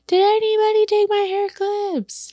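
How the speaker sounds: shrill-pitched mock yell, held back in volume; mom's mock scream. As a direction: mimic mom yelling through the house, high. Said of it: "like, screaming".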